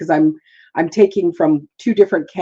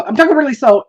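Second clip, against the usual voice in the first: hurried, louder demo of speeding up; rushed fast take; 6 semitones higher, 5 dB louder, faster than usual. Shown as a demonstration. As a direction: speed through the words without noticing. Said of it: "now I'm really saying it fast".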